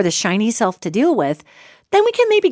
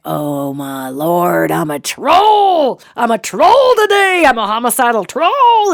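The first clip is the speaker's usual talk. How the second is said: mock troll rant; 4 dB louder than usual. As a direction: ham up a dramatic meltdown to a friend. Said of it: "you're like".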